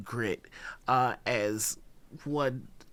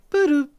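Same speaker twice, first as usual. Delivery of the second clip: loud high two-note chime; success chime; 13 semitones higher, 10 dB louder than usual. Acted. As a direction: imitate the card reader approving. Card terminal accept beep.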